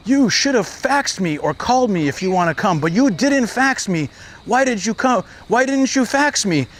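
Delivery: hammed-up monk demanding answers; mock angry monk. Monk chasing him, scolding.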